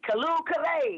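high-pitched joyous exclamation; gleeful, lifted storybook cheer; joyful chortle. A narrator's reading. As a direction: cry out in delight, as in a poem. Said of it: "he chortled in his joy".

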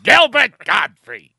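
loud, high, drawn-out squawking comic impression; Gilbert Godfrey. Shown as a demonstration. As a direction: belt it out in a grating comedian's screech. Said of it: "doing my best Gilbert Godfrey".

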